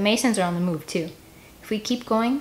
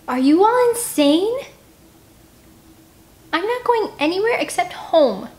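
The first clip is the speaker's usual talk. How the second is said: outraged; 11 semitones higher, 5 dB louder than usual. Acted. Scarlet refusing the trip, high outraged outburst. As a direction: scoff at them all.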